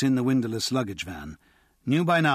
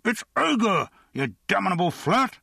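irritated shout; 9 semitones higher than usual. Acted. Agar answering from the coffin.

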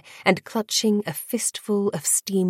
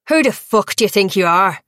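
louder, fast furious challenge; angry demand; 6 dB louder, much faster than usual. A narrator's reading. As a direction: demand it in his face. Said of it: "Shay demanded".

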